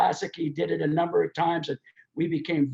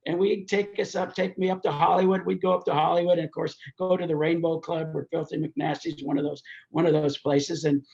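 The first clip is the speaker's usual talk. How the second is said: ordinary talk, nothing marked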